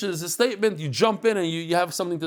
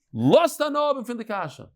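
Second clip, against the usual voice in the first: higher, pushed repeat of "Lost hanob and fin de kasha" over a shouting hall; raised over the noise; 7 semitones higher than usual. Retold or imitated. Rabbi calling over screaming students, raised higher. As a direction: call it out higher to cut through the yelling. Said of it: "So he would say in Yiddish".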